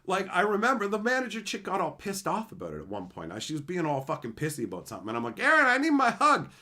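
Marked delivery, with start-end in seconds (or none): 5.4-6.5 high, loud call across the room. Retold or imitated. Calling to Aaron across the office, loud. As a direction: call to your friend across the floor.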